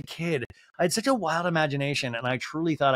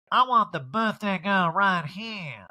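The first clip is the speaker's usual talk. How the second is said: slow, high put-on drawl; showy, commanding impression; Erica; 7 semitones higher, much slower than usual. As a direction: stretch out the demand. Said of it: "She's like".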